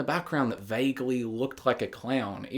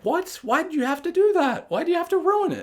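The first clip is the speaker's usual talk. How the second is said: exasperated whine. Retold or imitated. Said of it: "And then it's like".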